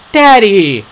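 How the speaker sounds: high, loud cry of relief. A narrator's reading. Daughter crying out on finding her father. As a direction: call out to him across the room. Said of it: "she cried".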